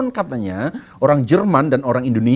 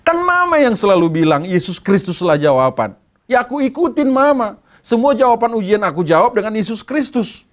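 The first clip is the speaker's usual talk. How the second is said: put-on child talking back; 9 semitones higher than usual. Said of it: "Anaknya marah balik sama mamanya".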